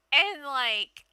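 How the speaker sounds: normal talking voice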